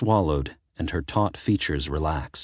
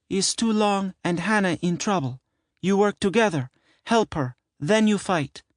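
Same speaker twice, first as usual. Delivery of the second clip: put-on high, earnest older woman with broken English. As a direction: plead with her son, worried mother.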